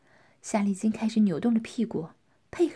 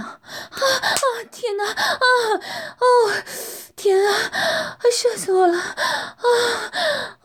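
much higher, louder cries; crying out; 13 semitones higher, 7 dB louder than usual. Acted. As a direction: cry out overwhelmed. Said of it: "夏丽晶大叫". (大叫)

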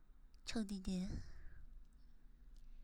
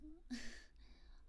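soft giggle; 5 semitones higher, 10 dB quieter than usual. Real, not acted. Giggles mid-request.

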